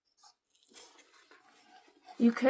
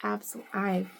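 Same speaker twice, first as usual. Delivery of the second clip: ordinary talk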